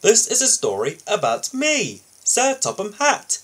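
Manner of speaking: hint of a booming boss; Fat Controller voice. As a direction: announce yourself as the proud boss. Fat Controller introduces himself in voice.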